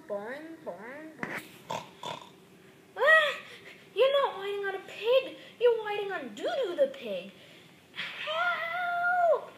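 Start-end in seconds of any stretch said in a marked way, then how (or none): 3.0-9.5 high, loud mock shriek; terrified scream. Scared toy shrieks at the ghost pig. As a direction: scream and beg for help.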